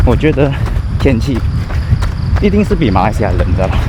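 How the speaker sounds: ordinary talk, nothing marked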